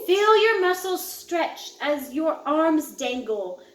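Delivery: ordinary delivery, nothing marked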